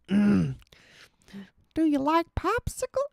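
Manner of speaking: put-on very high, thin creepy old man; Herbert voice. Shown as a demonstration. Streamer attempting Herbert voice again, very high. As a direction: squeak the line out like Herbert. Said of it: "I can't do it, bro".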